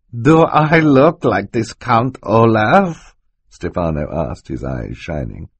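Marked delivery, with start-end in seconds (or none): 0.1-3.1 high, drawn-out incredulous question; hammed-up innocence, loud and slow; mock-innocent villain. A narrator's reading. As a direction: stretch it out slowly in feigned astonishment. Stefano feigns innocence when accused.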